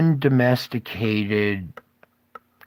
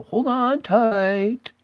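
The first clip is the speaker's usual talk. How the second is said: slow, higher, dramatic spooky voice; ominous warning; 10 semitones higher, much slower than usual. Pretend. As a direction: draw it out like a campfire warning, hammed-up. Warning viewers of ugly truth.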